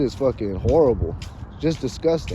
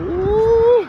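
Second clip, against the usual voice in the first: high teasing ooh; 5 dB louder than usual. Retold or imitated. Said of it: "I'm just like".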